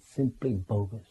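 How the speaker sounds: very soft, low, slow dismissal; quietly write it off, drawn out; imitated guru's hushed, low verdict; imitating Prabhupada. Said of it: "he said it in such a wonderful way".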